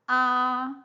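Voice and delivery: "a" held high and level; first tone. Shown as a demonstration. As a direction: sustain "a" on one high, unchanging pitch. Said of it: "if we apply the first tone".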